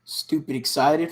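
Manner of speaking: normal talking voice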